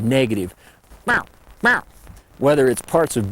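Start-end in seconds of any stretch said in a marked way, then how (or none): 1.0-1.8 higher mew with a gravelly edge; gravelly warning mew. Shown as a demonstration. Showing the bad gravelly mew sound. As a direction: put grit into the mew. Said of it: "that gravelly quality is bad".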